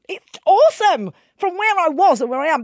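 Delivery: loud, high burst of delight; re-enacted elated outburst; excited realisation. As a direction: exclaim it like a sudden revelation. Retells sudden realisation about her life.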